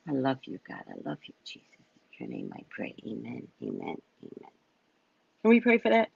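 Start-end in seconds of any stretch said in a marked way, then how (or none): 0.1-4.5 closing prayer. Acted.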